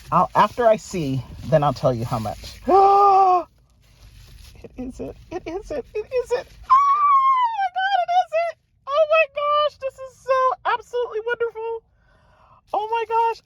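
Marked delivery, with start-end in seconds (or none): 2.6-3.4 sharp high gasp of delight; a loud excited gasp. Real, not acted. Unboxing a longed-for catalog. 4.6-13.4 squealing high with delight; high, thrilled disbelief; overjoyed squeal; real excitement. Real, not acted. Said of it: "I'm so excited".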